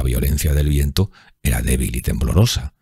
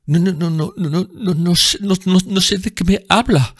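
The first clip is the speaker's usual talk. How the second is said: high, panicked stammer; frightened stammering denial. A narrator's reading. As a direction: stumble over the no's in terror. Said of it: "tartamudeó".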